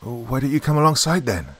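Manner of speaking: slightly irritated query; somewhat snappish. A narrator's reading. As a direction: ask it with a touch of impatience. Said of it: "I queried somewhat snappishly".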